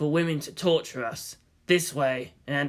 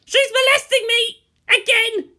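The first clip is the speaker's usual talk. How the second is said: put-on shrieking girl; 9 dB louder than usual.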